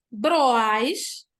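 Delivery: "broais" emendado, mais forte no meio; sílabas juntas. Shown as a demonstration. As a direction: junte O e A em "broais". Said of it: "fica junto". ("broais" com vogais unidas, bem marcado)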